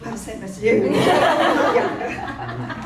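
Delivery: loud laugh through the words. Real, not acted. Guest returning the host's compliment, laughing loudly. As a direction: laugh it off, delighted.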